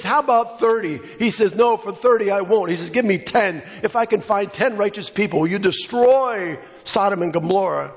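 high, pressing preacher voice for Abraham; preached bargaining. Acted. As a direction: haggle with God from the pulpit.

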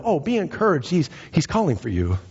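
high, suddenly eager crowd voice; put-on eager encouragement, falling at the end; mock encouragement. Critics now cheering on the blind man, mock eagerness. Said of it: "are now telling him".

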